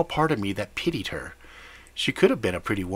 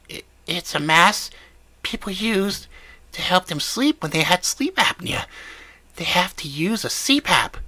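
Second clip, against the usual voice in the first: high whiny voice; 9 semitones higher than usual. Acted. Old woman explains the mask.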